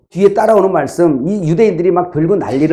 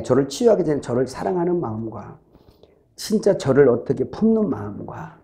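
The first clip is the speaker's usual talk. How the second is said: ordinary talk, nothing marked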